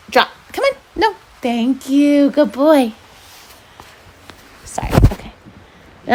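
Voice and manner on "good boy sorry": ordinary delivery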